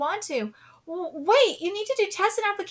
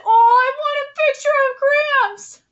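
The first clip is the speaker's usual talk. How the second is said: high, loud, fast squeal of emotion; overwhelmed fan squeal; emotional squeal; 10 semitones higher, 9 dB louder than usual. Real, not acted. Fan reacts emotionally to panel.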